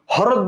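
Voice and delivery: হ্রদ said as "ho-rod"; wrong sounded h. Shown as a demonstration. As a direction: pronounce the h of হ্রদ as its own syllable. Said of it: "হ্রদ বলি না".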